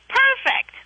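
high, bright mock delight; delighted perfect. Telling her husband the old lady sounds perfect, mock delight. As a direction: leap up in pitch with glee. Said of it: "I'm like".

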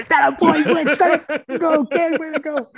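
high, loud laughing cheer; excited cheering. Retold or imitated. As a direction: celebrate the goal with glee. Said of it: "So I calm the hell down".

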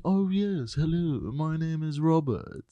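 put-on genteel old-time British demonstration; old British accent. Shown as a demonstration. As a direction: introduce yourself slowly in refined British. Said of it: "they would have spoken like".

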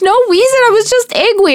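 put-on squeaky kid blurting it out; imitating her son. Mother retells her son admitting anger, high child voice. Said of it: "He goes".

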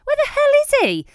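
frantic, getting shriller as you search for him; rising panicked shout. Retold or imitated. Wife searching the house for her husband, rising shout. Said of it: "in my drunk head, I was like".

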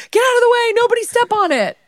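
high panicked shout; panicked yell. Retold or imitated. Panicked yelling about broken glass.

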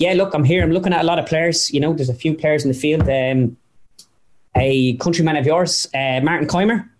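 imitated Irish golfer, pitch climbing toward the punchline; Padraig Harrington. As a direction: play the earnest golfer sizing up rivals. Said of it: "So then it goes to Harrington".

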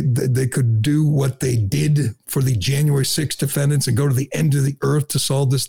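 normal talking voice

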